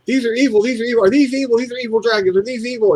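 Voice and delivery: high, insistent chant of alarm; imitated freak-out. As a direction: mimic a panicking customer repeating herself. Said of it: "kept saying it over and over".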